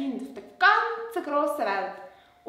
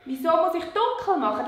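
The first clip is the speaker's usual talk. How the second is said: ordinary talk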